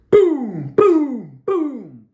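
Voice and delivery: loud, high "boom" shouted out like gunfire; three loud gunshot booms, each one punched; imitated gunshots. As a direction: bark out each boom like a shot going off. Imitating gunshots fired around property.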